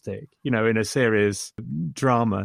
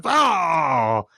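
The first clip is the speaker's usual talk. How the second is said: retold outburst of frustration; mock frustrated yell; 3 semitones higher than usual. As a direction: blurt out a frustrated cry. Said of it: "sort of goes".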